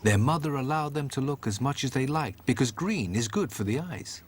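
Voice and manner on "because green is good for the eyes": usual speaking voice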